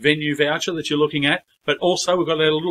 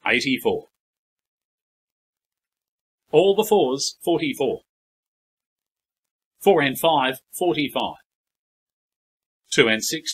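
steady rhythmic bingo number calls; bingo caller. Acted. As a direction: call each number, pause, then the total.

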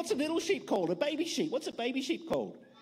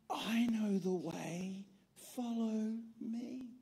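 little lamb voice; 6 dB quieter, much slower than usual. Acted. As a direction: calmly offer the way. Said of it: "heard a little voice".

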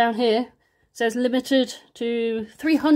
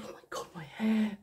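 whisper; 8 dB quieter than usual. Real, not acted. Notices messy hair on camera.

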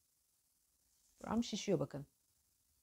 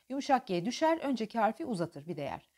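normal talking voice

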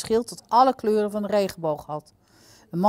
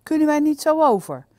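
high, louder startled question; taken aback; 9 semitones higher, 6 dB louder than usual. A narrator's reading. Tsuyuri to the guard on hearing of the toll, taken aback. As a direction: protest in surprise, pitched well up.

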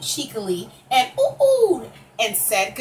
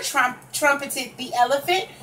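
ordinary delivery

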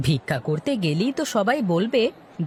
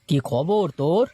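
ordinary talk, nothing marked

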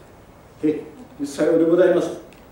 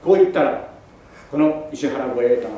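plain reading voice

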